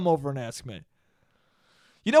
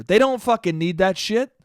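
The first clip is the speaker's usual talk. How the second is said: ordinary delivery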